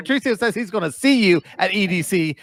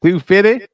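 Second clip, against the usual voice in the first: "Two fifty" louder, both words punched; emphatic; 3 semitones higher, 6 dB louder, slower than usual. Real, not acted. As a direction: hit "Two fifty" hard as a repeat. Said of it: "He said it with emphasis".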